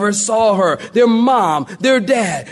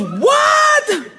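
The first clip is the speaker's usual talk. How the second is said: a stunned yelp of disbelief; shocked shout. Retold or imitated. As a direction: salesman hearing that the father owns everything. Stunned salesman reacting.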